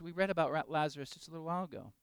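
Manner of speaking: normal talking voice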